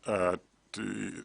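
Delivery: usual speaking voice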